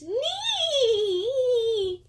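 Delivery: high, squeaky goblin cry; goblin squeal. Shown as a demonstration. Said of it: "I like to go like this".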